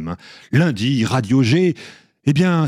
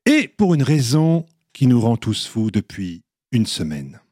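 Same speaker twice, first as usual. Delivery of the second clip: usual speaking voice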